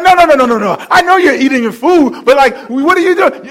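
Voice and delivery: husband insisting to his new wife; insistent protest. Retold or imitated. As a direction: refuse to let it pass. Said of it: "I'm like".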